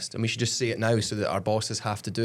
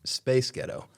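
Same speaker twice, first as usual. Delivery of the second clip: put-on American accent; much slower than usual. Shown as a demonstration. Co-host demonstrating on request, American accent. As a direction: give a model American rendering. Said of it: "Do it".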